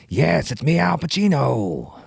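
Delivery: loud, high, drawn-out star impression; bad Al Pacino. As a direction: stretch the name out in a hammy bellow. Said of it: "just a very bad one doing rapping".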